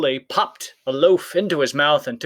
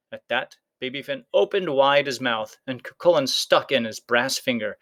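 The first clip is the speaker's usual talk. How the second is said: usual speaking voice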